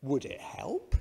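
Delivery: put-on calm accented reply, barely moved; a touch of a Russian accent, unfazed; mild Russian accent. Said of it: "Same reply".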